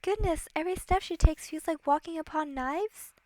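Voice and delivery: high, dainty storybook heroine; dismayed princess. Acted. Princess aghast at the cruel book she reads, dismayed.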